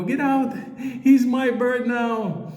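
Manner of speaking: put-on high, stretched-out old lady shout. Old lady telling Cameron to get out: high and drawn out. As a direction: drag the words out, defending her pet.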